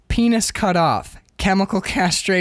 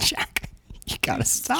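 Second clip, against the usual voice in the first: hushed plea; 5 semitones higher, 7 dB quieter than usual. Acted. Mary pleading with Jack.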